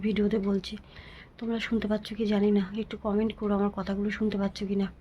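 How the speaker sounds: normal talking voice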